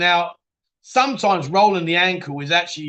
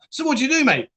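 lightly acted curious stranger, pitched up; casual mock question; 7 semitones higher, faster than usual. Stranger asking a new mate his job, casual mock question. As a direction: ask it high, like breezy small talk.

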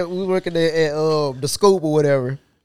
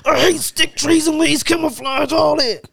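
high, slow-starting tirade; mock rant; 9 semitones higher, slower than usual. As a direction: go off on an exaggerated rant. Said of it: "going a whole rant".

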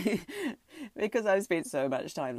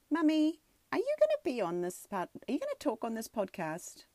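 imitating a little girl, much higher; daughter's voice; 9 semitones higher than usual. Daughter asking mum if she'll be on the podcast, lightly imitated child voice.

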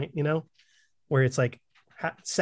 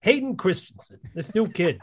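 higher and louder, hammed-up studio exec; mock producer; 4 semitones higher, 6 dB louder than usual. Mocking casting to a friend, hammed-up producer. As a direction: sell the idea like a dumb exec.

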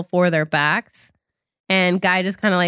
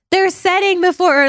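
high mocking voice; 11 semitones higher, 3 dB louder than usual. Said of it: "Maddox is like".

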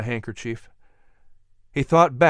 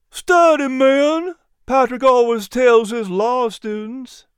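high, louder small-animal voice; chirpy little dog quoting Patrick; high, eager dog voice; little dog character voice; 10 semitones higher, 4 dB louder, slower than usual. A narrator's reading. Miz P answers.

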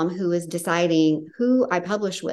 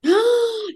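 high sudden gasp of surprise; sharp surprised gasp; 3 dB louder than usual. Real, not acted. Host hears the author got pregnant at the retreat, gasping. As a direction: gasp at the big news.

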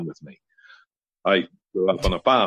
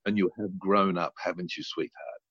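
softer, suggestive; mother teasing about nudity, softer; sly mother innuendo; 7 dB quieter than usual. Retold or imitated. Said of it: "and she goes".

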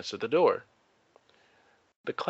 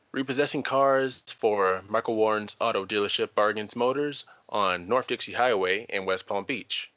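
ordinary delivery

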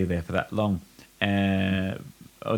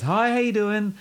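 friendly builder; 13 semitones higher than usual. Retold or imitated. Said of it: "builder greeting".

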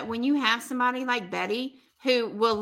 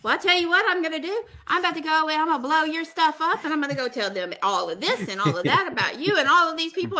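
fast, higher-pitched tirade; retold threat; 4 semitones higher, faster than usual. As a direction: rattle off the threat in a rush. Retold threat to expose Kevin.

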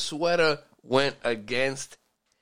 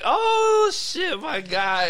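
high, loud, drawn-out mock hype; slow whooping shout of surprise; excited greeting; 5 dB louder, much slower than usual. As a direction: hype him up like a club crowd spotting a celeb. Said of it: "they were like".

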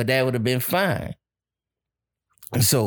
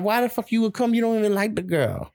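incredulous, pitched up high; mock indignant; 8 semitones higher than usual. Husband acting out his scoff at the partner wanting to come, pitched-up indignation.

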